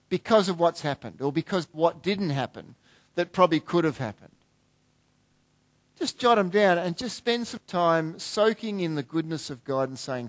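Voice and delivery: ordinary talk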